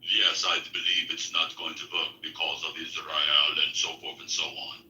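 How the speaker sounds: put-on low, hushed, accented mutter; low murmured imitation. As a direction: drop low and mumble it through. Quoting Igor's accent.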